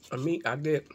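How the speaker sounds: talking while eating fish; chewing with hand over mouth; mouth full chewing. Real, not acted. Seen 0: Bites fish at -1, then chews, hand over mouth throughout.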